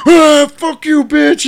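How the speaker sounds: high, very loud outraged yell; Toll Booth Willie. Retold or imitated. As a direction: explode at her in a high shout. Willie explodes at the lady.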